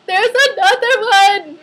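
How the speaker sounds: high, loud retold tearful cry; mock crying wail. Patient telling boyfriend of a second shot, mock crying wail. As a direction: wail it in hammed-up despair. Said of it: "And I was crying".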